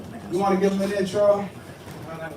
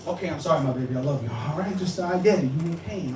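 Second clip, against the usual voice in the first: soothing apology; 6 semitones lower, 7 dB quieter, faster than usual. Retold or imitated. Soothing his grandmother in retelling.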